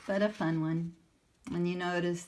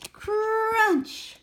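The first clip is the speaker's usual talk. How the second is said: loud, much higher crunching noise; 12 dB louder than usual. Acted. Sammy bites the painting with a loud, high crunch. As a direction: chomp it out loud.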